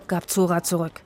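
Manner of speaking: ordinary delivery, nothing marked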